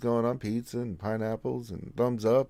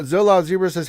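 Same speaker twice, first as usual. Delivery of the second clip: usual speaking voice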